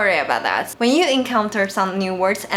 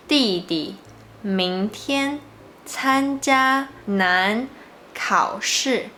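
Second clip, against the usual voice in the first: 弟弟, 明天, 参加难, 考试 each said alone, with pauses between; keywords spoken separately; much slower than usual. Shown as a demonstration. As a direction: pause after every keyword, 参加难 drawn out slowly. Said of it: "these are the keywords of this sentence".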